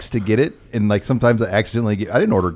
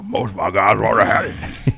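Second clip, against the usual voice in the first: lower-pitched coach imitation; Coach Orgeron; 4 semitones lower than usual. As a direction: lightly imitate a football coach on TV. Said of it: "he's like".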